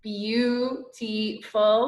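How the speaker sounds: Beautiful very slow, each sound drawn out; sounded out slowly. Shown as a demonstration. Teacher to class, sounding a word slowly. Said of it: "remember that was a short vowel sound".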